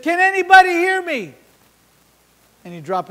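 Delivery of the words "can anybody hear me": Jesus calling out. Retold or imitated. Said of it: "and then he said".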